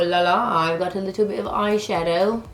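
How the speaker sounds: put-on, hammy British voice; bad British accent. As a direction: do a jokey, terrible British accent. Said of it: "worst any kind of British accent".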